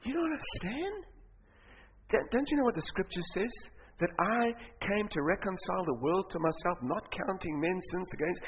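soft, tender explanation; gentle reassurance. Acted. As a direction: explain softly, as Jesus comforting a believer. Jesus answers the worried believer gently.

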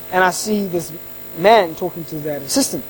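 usual speaking voice

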